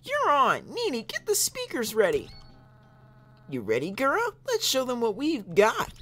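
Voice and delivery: put-on high eager idol girl; cute challenge to a rap battle; anime girl voice. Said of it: "try a chick voice".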